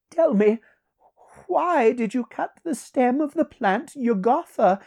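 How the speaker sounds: very high, loud theatrical stranger; courteous freed sorcerer. Acted. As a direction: address a king in a high, ringing voice.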